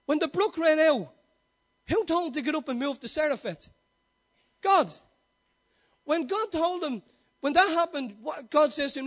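loud, driving questions with punched answers; preaching at full force. Acted. Preacher asking the congregation who led Elijah, forceful. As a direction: hammer each answer home.

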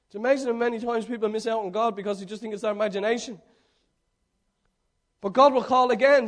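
usual speaking voice

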